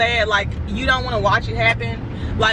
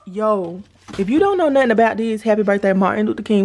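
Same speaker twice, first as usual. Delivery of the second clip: ordinary talk, nothing marked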